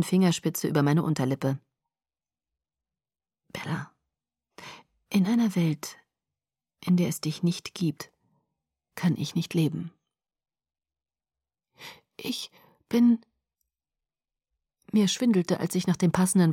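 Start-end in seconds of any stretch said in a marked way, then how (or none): 3.5-13.2 hushed, intimate, soft; tender whisper. Acted. Edward confessing love to Bella, whispered. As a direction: say it close and gentle.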